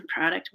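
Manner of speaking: product with an AH, softly, PRAH-duct; rejected pronunciation. Shown as a demonstration. Trying the other way, product as a soft PRAH-duct. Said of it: "If it was supposed to be product".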